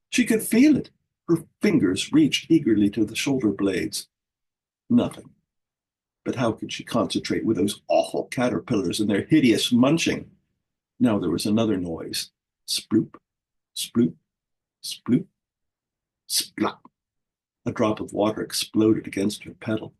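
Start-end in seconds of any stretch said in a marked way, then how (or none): 0.1-0.9 excited. A narrator's reading. Repeat builds excitement. 12.7-16.8 drip sounds. A narrator's reading.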